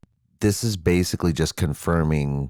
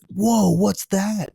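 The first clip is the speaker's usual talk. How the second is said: very high, drawn-out mock wonder; amazed puppet mouse; much slower than usual. Mouse spotting a chunk of cheese, high amazed voice. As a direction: squeal like a mouse spotting treasure. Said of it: "start to have the conversation like".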